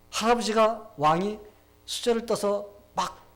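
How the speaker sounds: ordinary delivery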